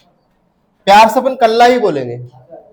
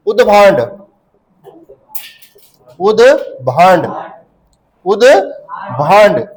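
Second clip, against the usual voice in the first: उदभांड said again and again, each time slower and more drawn out; slowing repetition; 3 semitones lower, much slower than usual. Shown as a demonstration. Teacher drilling a new place name for the class, slower each time. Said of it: "एक और नाम है इसका".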